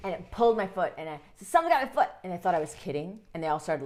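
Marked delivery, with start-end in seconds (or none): 1.5-2.1 higher, louder alarmed call; retold yell. Boy calling to laughing family, louder and alarmed. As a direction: shout it out in alarm.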